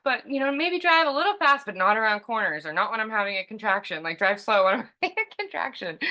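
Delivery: high and fast, words tumbling out in half-laughing fluster; a woman in labor gasping out driving orders, flustered and giggly. Retold or imitated. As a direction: rattle off the instructions in a breathless, giggly rush. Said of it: "And I'm like".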